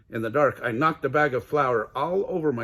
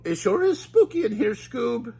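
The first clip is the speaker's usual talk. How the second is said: high louder spooked Shaggy; high scared Shaggy to Scooby; nervous Shaggy; 12 semitones higher, 5 dB louder than usual. Acted. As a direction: creep through the dark nervously. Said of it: "Shaggy said".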